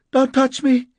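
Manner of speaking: high, loud recoil; alarmed woman warding him off; frightened. A narrator's reading. Woman backs away from the narrator, frightened.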